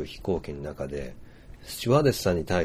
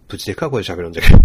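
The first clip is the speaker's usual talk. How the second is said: much louder and faster, booming; 15 dB louder, much faster than usual. Shown as a demonstration. Showing how his Hiroshima friend talks on the phone, loud and fast. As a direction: boom it out like shouting into a phone.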